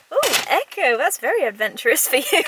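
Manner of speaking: loud, high delighted surprise; amused loud praise for the cat; delighted exclamation. Real, not acted.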